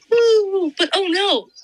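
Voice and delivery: cheer, then fake alarm; mock cheer then dismay. Real, not acted. Friend summing up Percy's story, mock cheer then dismay.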